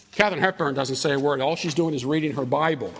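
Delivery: ordinary delivery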